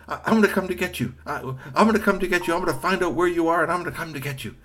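high, fast promise to come; urgent promise. Acted. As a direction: rush the reassurance. Boy promising rescue.

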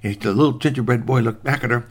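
usual speaking voice

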